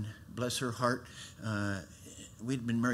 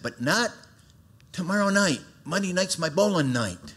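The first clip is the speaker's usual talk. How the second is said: animated retelling; 7 semitones higher, 6 dB louder than usual. Retold or imitated. Retold eager bowling excuse.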